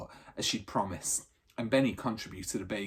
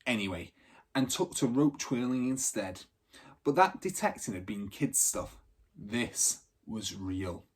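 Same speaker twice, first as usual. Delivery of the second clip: ordinary delivery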